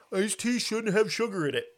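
put-on voice of a tea snob; mocking quote. Mocks anyone disliking sweet tea.

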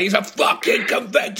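high-pitched mock outburst; hammed-up furious exclamation; imitated anger. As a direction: imitate someone losing it in anger. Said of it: "you were just angry with him".

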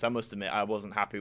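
ordinary talk, nothing marked

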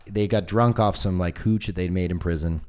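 ordinary talk

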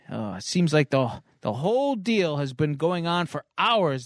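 ordinary delivery

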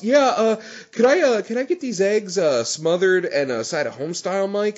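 Waffle House customer. Pretend. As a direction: order breakfast like a regular. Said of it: "It sounds like something you would order at Waffle House".

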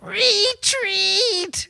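high screeching retreat cry; Cobra Commander. Retold or imitated. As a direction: shriek it like a cartoon villain. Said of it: "does this ring a bell".

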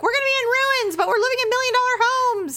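high sarcastic mimicry; mocking high voice. Mocking quote of the family.

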